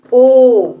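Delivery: ओ said long and loud, round lips; loud drawn-out o. Shown as a demonstration. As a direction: teach ओ as a full open o. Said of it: "पढ़ेंगे इसको ओ". (ओ को ज़ोर से लंबा बोलना)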